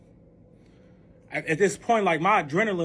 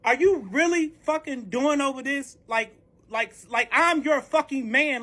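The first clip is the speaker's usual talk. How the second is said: high-pitched incredulous tirade; worked-up reenactment of a furious argument; outraged confrontation; 7 semitones higher than usual. Retold or imitated. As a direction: fire back in indignant disbelief. Retold argument, firing back at partner.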